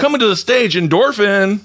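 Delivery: high, hyped announcer call; drag show announcer. Pretend. Introducing the drag queen to the crowd, high hyped MC call. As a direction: bring her on stage like an MC.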